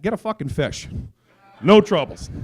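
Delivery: normal talking voice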